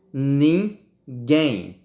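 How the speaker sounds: ninguém em duas partes, GUÉM alongado; tônica na última. Shown as a demonstration. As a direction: separar "ninguém", apoiando a sílaba final GUÉM. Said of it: "você não bota mais força para falar". ("ninguém" com força no GUÉM final)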